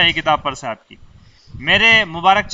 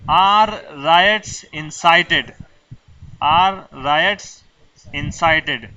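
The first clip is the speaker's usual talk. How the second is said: each word laid out slowly for students to copy; slow dictation; much slower than usual. Shown as a demonstration. Teacher dictating to students, very slow.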